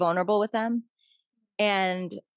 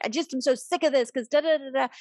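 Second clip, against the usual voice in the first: higher, mock-furious venting; a hint of a fed-up crying friend; imitating furious friend; 6 semitones higher than usual. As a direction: act out a friend's angry rant, pitched up. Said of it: "and she's furious".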